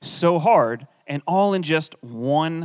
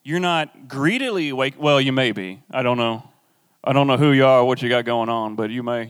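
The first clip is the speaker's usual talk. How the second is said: ordinary talk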